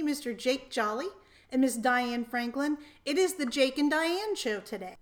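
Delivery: usual speaking voice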